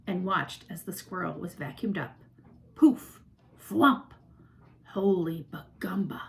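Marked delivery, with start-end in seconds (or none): 2.7-4.1 loud punchy noise words; comic sound effects. A narrator's reading. 4.9-6.2 whispered in disbelief; girl stunned at the window; awed whisper. A narrator's reading. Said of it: "said Flora".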